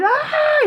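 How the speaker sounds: high-pitched mock scream; sudden very high imitated shriek. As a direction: scream like a horrified film character. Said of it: "he, he screamed".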